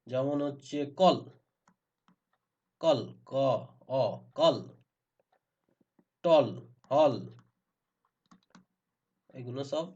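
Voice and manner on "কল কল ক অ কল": "কল" with a clear rounded aw; "aw" vowel. Shown as a demonstration. Teacher showing that A can give অ, clear aw in "কল". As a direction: pull out the অ sound in "কল". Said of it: "অ দেয়".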